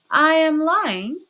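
high, loud, drawn-out incredulous question; mock indignation. A narrator's reading. Porfiry throws Raskolnikov's accusation back, mock indignant.

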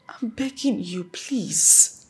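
hushed, heartfelt begging; soft pleading. Acted. Daughter begs mother to accept the man she loves, soft pleading.

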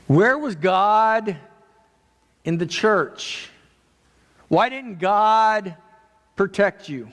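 high, slow, drawn-out questioning; challenging questions. Retold or imitated. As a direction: voice the doubters, stretching out each question. Mimics doubting network talking heads.

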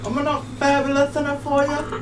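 mock diva. Friend teasing friend in a mock diva pout. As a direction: toss it off like a wounded diva.

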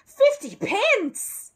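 aghast. A narrator's reading. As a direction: cry it out like a man robbed. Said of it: "looked positively devastated".

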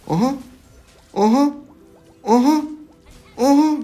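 high, loud excited calls of aha; rising triumphant aha shouts. Real, not acted. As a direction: crow with delight at each hit.

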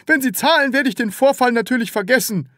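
high, fixed-grin salesman voice; stiff smile. A narrator's reading. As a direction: say it through a frozen smile.

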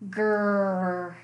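low drawn-out growl; bear growl. Acted. Mysterious sound over the windowsill, low bear growl. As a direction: rumble it deep from the belly. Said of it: "Grrr rumbled from the bear's belly".

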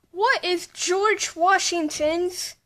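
ordinary talk

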